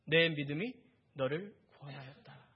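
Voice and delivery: calm affirmation with small pauses; gentle praise. Acted. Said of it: "그녀를 칭찬해 주셨어요".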